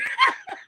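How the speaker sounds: a short shriek of laughter; sudden high laugh. Real, not acted. Mother laughing at the training joke.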